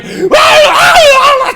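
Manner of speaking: high, loud cartoon-coward scream; panicked screaming. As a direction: shriek like a fleeing cartoon dog.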